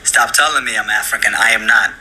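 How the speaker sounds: usual speaking voice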